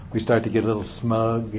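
normal talking voice

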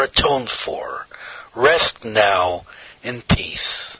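low, hushed, slowing to a near whisper; soothing blessing. A narrator's reading. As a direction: lay him gently to rest, softly. Holy voice releases dying man.

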